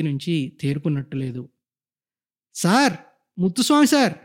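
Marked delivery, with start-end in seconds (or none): 2.5-4.1 higher calls across the veranda; calling out. Acted. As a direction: call him from far off. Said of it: "అరిచాను".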